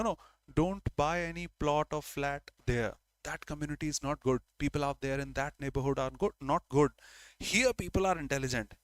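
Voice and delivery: ordinary delivery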